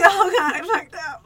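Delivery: very high mock-panicked whine; voice gone up. Storyteller replaying her panic at meeting the date, squeaky. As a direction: squeak it like a laughing near-sob. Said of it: "Your voice went up".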